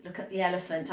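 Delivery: usual speaking voice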